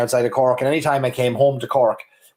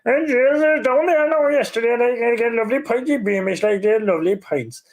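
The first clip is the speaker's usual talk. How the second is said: put-on high sing-song Cork old man; nasally old man; 10 semitones higher than usual. Shown as a demonstration. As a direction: whine it through the nose, high and lilting. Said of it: "talking in a kind of a nasally old man way, like in a sing-song voice".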